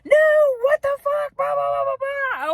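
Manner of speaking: high-pitched mock yell, ranting; retold yell. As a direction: squeal it out like a mock outburst at the cops. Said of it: "I was yelling".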